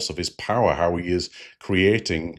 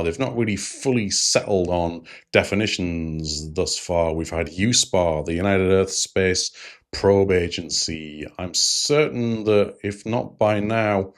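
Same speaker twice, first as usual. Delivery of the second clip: ordinary delivery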